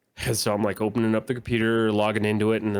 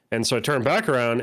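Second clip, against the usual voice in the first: ordinary talk, nothing marked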